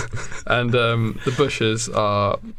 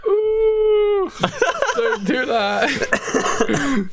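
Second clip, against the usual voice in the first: high squealing mock fright, slow at the end; mock-frightened politician, falsetto squeal; frightened Tony Blair; much slower than usual. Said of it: "Tony Blair's like".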